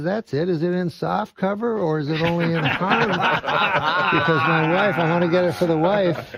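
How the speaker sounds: high, loud, laughing obliviousness. Retold or imitated. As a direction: shrug it off cheerfully. Oblivious redneck punchline.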